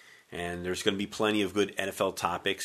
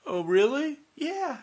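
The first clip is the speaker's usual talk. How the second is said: mock wife sniffle; slower than usual. Imitating wife.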